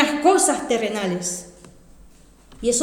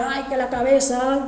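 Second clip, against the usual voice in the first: quejido suave, más bajo de volumen; llanto imitado; 5 dB quieter than usual. Retold or imitated. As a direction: imita un lamento lloroso por el dolor. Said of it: "y llorando". (quejándose entre lágrimas, sin llorar de verdad)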